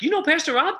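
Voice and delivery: surprised. Retold or imitated. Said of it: "the tailor's like".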